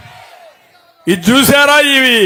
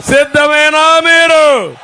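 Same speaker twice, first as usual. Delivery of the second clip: slow, drawn-out shout to the crowd; rally call; 6 semitones higher, slower than usual. Real, not acted. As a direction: call out and wait for the roar. (సభికులను అడుగుతూ నెమ్మదిగా గట్టి అరుపు)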